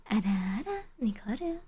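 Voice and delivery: breathy teasing ara ara; whispered ara ara. Pretend. Streamer whispering ara ara to chat. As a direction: whisper it like a coy tease.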